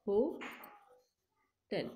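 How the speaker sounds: "Ho" and "tell" split apart with a long pause; sounding out words. Shown as a demonstration. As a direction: break "Hotel" into "Ho" and "tell". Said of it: "Like this, you have to break the".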